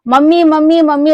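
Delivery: put-on a child yelling for mummy in delight; excited shout. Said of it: "बड़ी तेज वो चिल्लाई".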